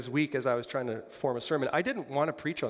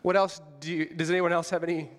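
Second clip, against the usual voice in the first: normal talking voice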